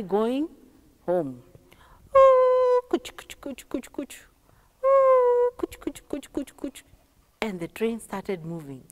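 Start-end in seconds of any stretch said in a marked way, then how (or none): 2.2-2.8 high, loud "ooh" hoot; long high-pitched hoot; train whistle. Acted. 2.9-4.2 soft percussive chugs; quiet rhythmic chug-chug; train chugging. Acted. Imitating the moving train. 4.8-5.5 high, loud "ooh" hoot; long high-pitched hoot; train whistle. Acted. 5.6-6.8 soft percussive chugs; quiet rhythmic chug-chug; train chugging. Acted. Imitating the moving train.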